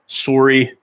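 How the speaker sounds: Canadian "sorry", rounded SOR-ee; Canadian accent. Real, not acted. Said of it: "There's the Canadian accent".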